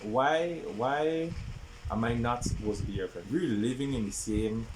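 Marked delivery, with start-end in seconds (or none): none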